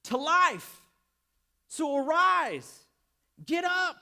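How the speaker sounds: loud, high, drawn-out command; ringing preacher's cry; yelling. Acted. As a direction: shout it to rouse the congregation. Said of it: "Jesus wants to yell at you this morning".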